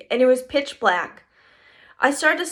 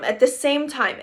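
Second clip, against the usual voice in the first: ordinary delivery, nothing marked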